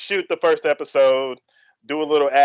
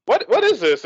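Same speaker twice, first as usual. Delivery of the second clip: retold bafflement; 6 semitones higher than usual. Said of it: "I have no idea what's going on".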